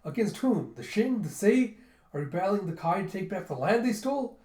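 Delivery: high, loud eager questions tumbling out; all excited. Retold or imitated. Gunte eagerly asking the king about the war. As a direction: fire off the questions, thrilled. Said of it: "all excited".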